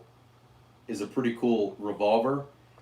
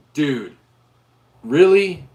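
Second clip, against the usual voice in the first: incredulous; 3 semitones higher, 10 dB louder, much slower than usual. Retold or imitated. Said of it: "And I'm like".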